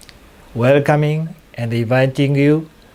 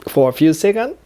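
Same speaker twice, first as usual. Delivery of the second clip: guided hypnosis. Acted. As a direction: guide gently, stretching out the key words.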